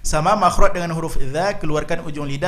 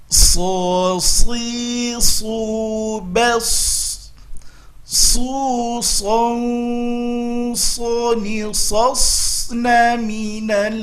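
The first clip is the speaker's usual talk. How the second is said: slow Quran recitation drill; 6 semitones higher, much slower than usual. Shown as a demonstration. Teacher leading students in a slow drill. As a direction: chant each syllable slowly and clearly.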